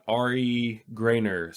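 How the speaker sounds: ordinary talk, nothing marked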